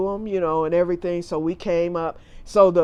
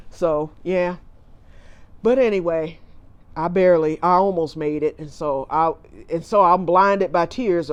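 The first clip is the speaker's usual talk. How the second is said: ordinary talk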